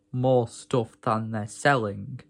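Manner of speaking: ordinary delivery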